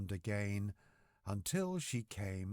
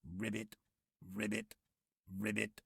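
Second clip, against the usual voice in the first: frog croak; 3 dB quieter than usual. A narrator's reading. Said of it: "all he ever said was".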